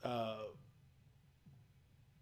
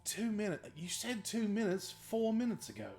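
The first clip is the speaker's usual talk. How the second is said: movie character quote; 8 semitones higher than usual. Retold or imitated. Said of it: "And he's like".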